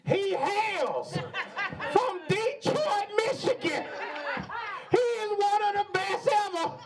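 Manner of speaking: high, stretched game-show host intro; Steve Harvey impression. Host introduces co-host as Steve Harvey would, stretched and high. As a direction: hype him up like a TV host. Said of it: "I'ma do this intro like Steve Harvey would".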